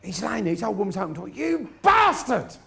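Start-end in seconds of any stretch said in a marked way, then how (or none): 1.3-2.6 furious shout. Acted. Wife opens door to drunk.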